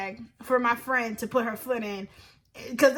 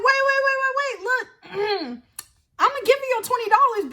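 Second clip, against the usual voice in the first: much higher, rushed backpedal; sudden panic; 8 semitones higher than usual. Retold or imitated. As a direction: panic and give in fast. Police actually arrive, panicking.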